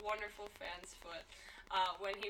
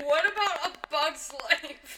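louder, slow indignant question; loud mock protest; 7 semitones higher, 12 dB louder, slower than usual. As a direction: challenge it loudly.

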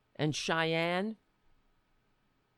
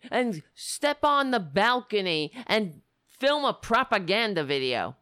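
ordinary talk

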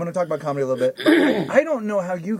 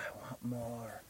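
put-on very soft, slow, low child vampire line; Kristen Dunst; 8 semitones lower, 23 dB quieter, slower than usual. Little vampire girl in the trailer, hushed and slow. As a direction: barely voice it, slow and hungry. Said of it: "little Kristen Dunst goes".

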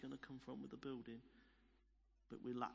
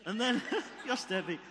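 loud, higher, laughing aside; 5 semitones higher, 10 dB louder than usual. Real, not acted. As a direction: break into real laughter mid-sentence. Laughs along with the congregation.

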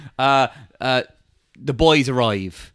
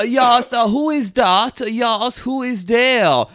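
higher, startled German sleeper; German accent; 7 semitones higher, slower than usual. Acted. German waking up in the back of the van, puzzled German accent. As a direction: wake up confused with a German accent. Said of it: "There's a German guy asleep in the back".

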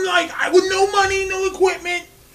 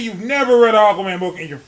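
normal talking voice

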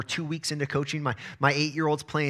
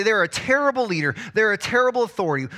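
higher, louder griping about a bad leader; complaining quote; 7 semitones higher, 5 dB louder than usual. Someone in church griping about their leader, higher and louder. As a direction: gripe loudly about the boss. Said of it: "and you say".

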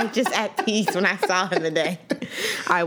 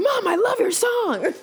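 mock kid fan; 13 semitones higher than usual. Imagining her kids gushing over mom's song, mock squeal. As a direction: squeal it like an adoring child. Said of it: "They're probably like".

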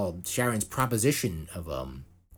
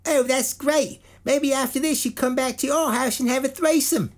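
very high, loud deadpan lady voice; mock Sharon Osbourne; 6 dB louder than usual. Quoting Sharon propositioning LeBlanc, very high mock lady. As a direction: offer it sweetly like small talk. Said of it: "she immediately goes".

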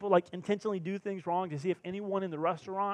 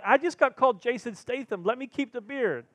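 playful plea; 7 semitones higher, 4 dB louder than usual. Retold or imitated. Pleading with wife playfully.

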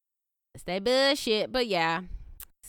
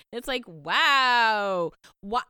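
normal talking voice

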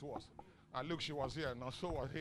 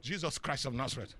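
ordinary delivery

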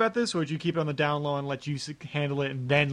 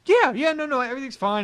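high, loud, overeager reassurance; acted-out cover-up cheer; falsely upbeat; 10 semitones higher, 5 dB louder than usual. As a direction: insist brightly and too quickly that all is well. Feigning all is well to parents.

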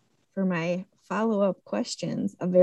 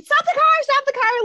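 high, loud whiny yell; whiny high-pitched shouting; mock scream; 5 dB louder than usual. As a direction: shriek it from the back seat. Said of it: "is screaming".

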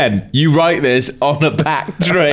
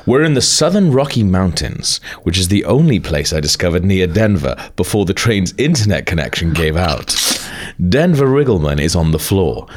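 low, measured narrator reading; story narration; 7 semitones lower than usual. Acted. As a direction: read it like a documentary narrator. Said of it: "You do the narrator thing then".

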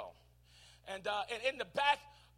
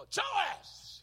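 higher summons across the room; calling out; 10 semitones higher, 5 dB quieter than usual. Pretend. King summoning Joash, a higher call. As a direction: call the name across the hall.

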